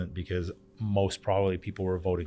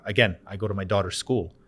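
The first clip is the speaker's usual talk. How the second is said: ordinary delivery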